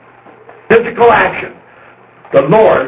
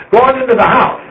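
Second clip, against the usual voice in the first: higher call that sends them on, go on into the house; 7 semitones higher than usual. Shown as a demonstration. Speaker out in the yard sends people inside with a higher call. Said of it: "you say".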